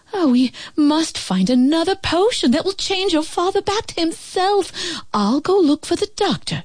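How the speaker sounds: worried mother. A narrator's reading. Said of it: "she said".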